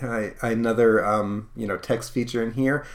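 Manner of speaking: ordinary talk, nothing marked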